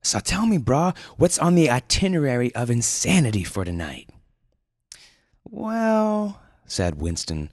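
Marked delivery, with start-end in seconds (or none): 0.0-4.1 much higher surfer-dude voice; high eager surfer dude; Julian's dude voice. Acted. 5.5-6.4 mock pondering. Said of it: "pretending to think".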